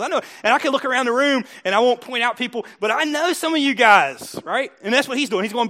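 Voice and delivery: normal talking voice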